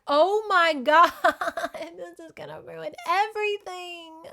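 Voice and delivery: loud laughing cry falling to a strained, high lament; mock screaming. Reader screaming at the novel, mock despair. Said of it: "you're just screaming in this novel".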